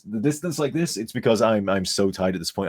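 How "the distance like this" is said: higher, turned away from the mic; off-mic, into the distance. Shown as a demonstration. Tired streamer showing viewers how off-mic he sounds, distant voice. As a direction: talk off into the distance. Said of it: "talking off into the dis".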